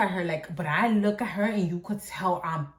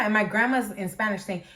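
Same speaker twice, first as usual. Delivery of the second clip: ordinary talk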